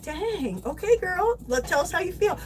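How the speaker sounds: high sassy comeback; sassy retort. Retold or imitated. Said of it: "I was like".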